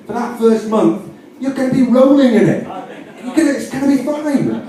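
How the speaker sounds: high, gleeful and giddy; excited. Real, not acted. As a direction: sell the big payoff. Excited, high, laughing pitch.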